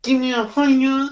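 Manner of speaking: high, loud yell; sudden squeaky shout. Real, not acted. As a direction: blurt it out at the top of your voice.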